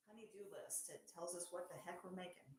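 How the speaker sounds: normal talking voice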